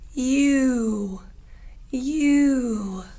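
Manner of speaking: a drawn-out, rounded "you"; long U demo. Shown as a demonstration. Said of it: "here is how we make the sound".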